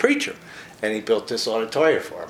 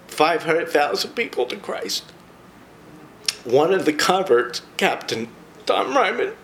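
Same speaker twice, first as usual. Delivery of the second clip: choked up; 5 semitones higher than usual. Real, not acted. Tearing up retelling the conversions.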